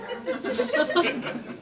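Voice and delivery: high burst of laughter; amused laugh. Real, not acted. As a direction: laugh it off when the demo fails. Laughs at demo failure.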